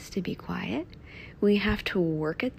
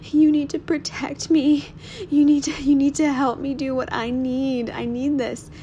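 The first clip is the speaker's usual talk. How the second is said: pleading, higher and louder, fading at the end; retold desperate plea; 7 semitones higher, 6 dB louder than usual. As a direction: beg to be protected, urgently. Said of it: "I was like".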